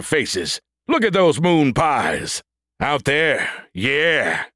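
drawn-out swaggering robot sergeant; cocky android soldier. A narrator's reading. Android Brody lecturing the rangers.

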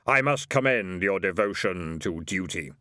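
low, hollow Death voice; Death's voice. A narrator's reading. Death praising the delivery man, low and hollow. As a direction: commend him gravely, as Death.

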